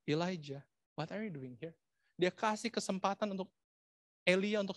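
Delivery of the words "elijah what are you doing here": softer, tender question; gentle voice. Shown as a demonstration. Preacher demoing God's gentle question to Elia, tender. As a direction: ask it softly, with kindness. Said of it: "Dengan suara yang lembut".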